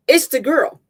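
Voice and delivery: high, loud burst of alarm; alarmed cry. Acted. River startled by the girl in the mirror, alarmed cry. Startled woman screaming at image.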